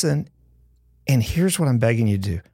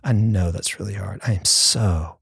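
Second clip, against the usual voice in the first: gentle comfort; 5 semitones lower than usual. Retold or imitated. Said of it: "I wish he would just said".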